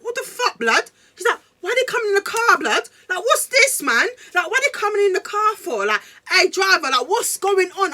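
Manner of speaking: high, loud panicky yelling; mock his freak-out in a high yell; imitating panicking boyfriend. Imitating boyfriend freaking out at Uber pool riders, high panicky yell.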